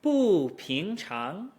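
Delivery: "Bu" falling firmly, "ping chang" rising; slow swoop down on "Bu" and up on "ping". Shown as a demonstration. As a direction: drop through "Bu" into the rises. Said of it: "We fall into the rise".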